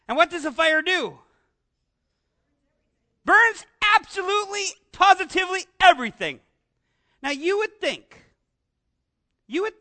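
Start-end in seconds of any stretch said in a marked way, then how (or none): 3.2-6.4 higher, louder, stretched out with awe; dramatic climax. A narrator's reading. Preacher telling the fire from heaven, awed and loud. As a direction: land the miracle with awe.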